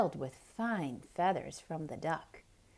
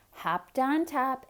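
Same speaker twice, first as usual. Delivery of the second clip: usual speaking voice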